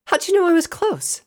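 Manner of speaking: very high, loud, eager asking; excited question. Acted. Rainbow excitedly asks after reunion.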